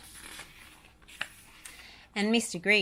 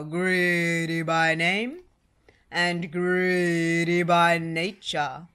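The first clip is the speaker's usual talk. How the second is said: loud, slow giant bellow; 6 dB louder, much slower than usual. A narrator's reading. Giant mocking Mister Greedy, slow and bellowing. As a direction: bellow it down at him, slowly. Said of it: "he bellowed".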